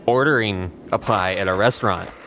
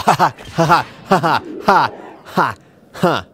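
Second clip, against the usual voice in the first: loud villain cackle; evil laugh; 6 semitones higher, 5 dB louder than usual. Acted. Evil laugh after "do the impossible.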